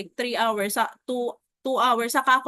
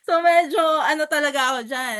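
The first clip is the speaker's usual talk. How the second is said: laughing, higher and louder; 4 semitones higher, 7 dB louder than usual. Real, not acted. Agent laughing about wanting to fight the client. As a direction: laugh it out while admitting frustration. Laughing at her own irritation.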